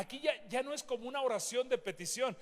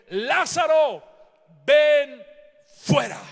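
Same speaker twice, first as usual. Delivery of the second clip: full-force shout, much higher and louder; 6 semitones higher, 14 dB louder, slower than usual. Acted. Preacher's climax as Jesus commands Lázaro, full shout. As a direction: roar the command with all your voice. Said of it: "la declaración de Jesús fue".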